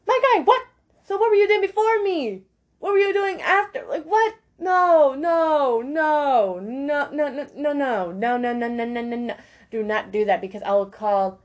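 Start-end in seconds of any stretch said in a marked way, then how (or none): 0.1-4.3 high, squeaky disbelief, much higher; shrieking in disbelief; incredulous. Real, not acted. Talking to a toxic partner, high incredulous squeak. 4.6-9.4 a string of rapid nos, fading; emphatic refusal. Real, not acted. Warning a partner off, repeated falling nos.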